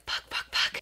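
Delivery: voiceless, barely-there whispered cursing; mimicking a friend's silent swearing; imitated whisper. Friend's furtive panicked swearing, retold.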